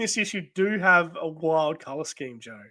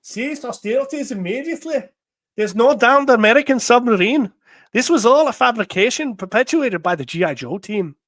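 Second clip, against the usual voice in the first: higher, louder put-on Scottish accent; 8 semitones higher, 8 dB louder than usual. Reading Destro's order to cease hostilities, Scottish accent. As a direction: read it in a lilting Scottish brogue. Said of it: "read it in a Scottish accent".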